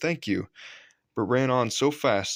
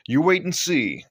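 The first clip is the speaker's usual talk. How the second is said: confident driver; 7 semitones higher, 6 dB louder than usual. A narrator's reading. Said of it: "said Edward's driver".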